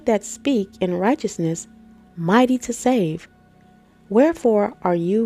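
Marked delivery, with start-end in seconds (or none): none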